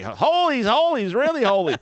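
a hammed-up eager shout of holy, holy; mock enthusiasm. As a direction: overdo the excitement for a laugh. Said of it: "you're not saying it with enough enthusiasm".

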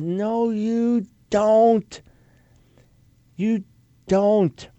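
slow, drawn-out flat denial; emphatic slow rebuttal; firm no. Real, not acted. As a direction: shut the claim down slowly. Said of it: "firm correction, slow".

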